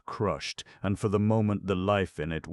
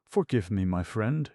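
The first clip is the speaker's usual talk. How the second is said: tender and tearful; 5 semitones higher than usual. A narrator's reading. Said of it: "she said tenderly and with tears in her voice".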